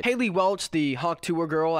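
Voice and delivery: ordinary talk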